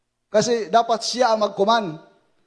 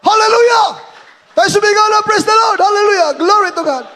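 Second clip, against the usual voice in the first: loud, high praise cry; preacher rousing the congregation to shout; joyful shout; 9 semitones higher, 8 dB louder than usual. Real, not acted. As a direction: lift the crowd in praise.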